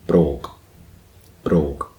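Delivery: "brogue" ending in a K; final K sound. Shown as a demonstration. Learner explaining Gaelic G, "brogue" ended with a K. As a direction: say "brogue" like "broke", closing hard on K. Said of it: "pronounced with a K sound".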